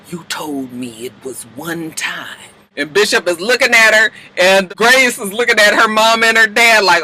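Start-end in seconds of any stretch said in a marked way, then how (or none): 0.1-2.4 low, much softer, slow; cold quiet accusation. Retold or imitated. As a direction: hiss it quietly, betrayed. May confronts Bishop coldly.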